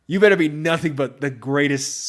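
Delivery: usual speaking voice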